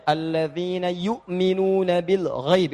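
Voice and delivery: slow chanted recitation with short yu'minuna; slow melodic Quran chant. Shown as a demonstration. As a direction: recite "yu'minuna" slowly with a normal short hamzah. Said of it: "saya baca Al-Baqarah ayat tiga".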